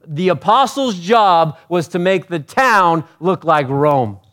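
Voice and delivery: high, loud preacher's teaching; impassioned preaching. Acted. Preacher teaching the congregation about Caesar's apostles, impassioned. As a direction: explain it at full pulpit volume.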